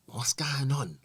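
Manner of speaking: whispered to himself; hushed, stunned; whispering. Retold or imitated. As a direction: whisper in disbelief. Processing quietly at back of bus.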